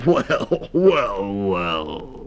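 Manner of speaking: far, slow toad drawl; old toad voice. Acted. Mister Toad voice.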